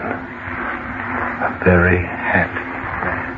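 breathy whisper, slow and fading; whispered echo. Acted. Dead man repeats his happiness in a slow whisper. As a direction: let it die away to a whisper. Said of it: "and I was a very happy man".

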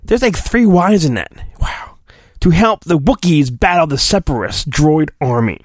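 usual speaking voice

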